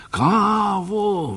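high excited cry of the word Kávu; Kávu thrown out high and delighted; exclaiming. Acted. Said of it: "vykřikl doktor". (nadšeně vykřikne Kávu)

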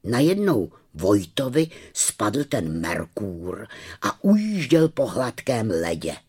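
ordinary delivery

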